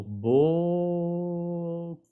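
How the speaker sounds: BEAUCOUP with a long, held "bo", almost sung; drawn-out O demo. Shown as a demonstration. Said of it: "y eso como la O".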